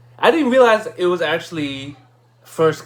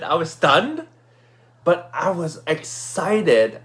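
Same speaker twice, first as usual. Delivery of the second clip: usual speaking voice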